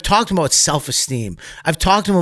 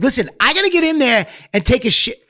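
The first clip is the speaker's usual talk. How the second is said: raised voice; 11 semitones higher, faster than usual. Retold or imitated. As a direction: yell it out, desperate to get to a bathroom. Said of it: "I raised my vo".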